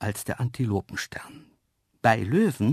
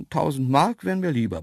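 usual speaking voice

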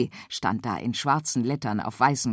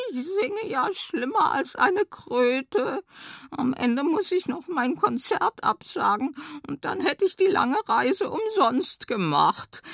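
whining frog; 11 semitones higher than usual.

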